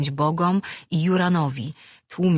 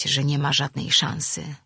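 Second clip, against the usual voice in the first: plain reading voice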